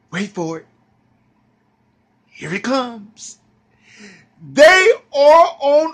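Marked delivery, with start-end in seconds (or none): none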